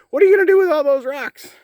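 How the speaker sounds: high squeaky mock voice; Uncle Rick voice. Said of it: "my Uncle Rick asked".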